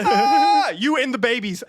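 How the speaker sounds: normal talking voice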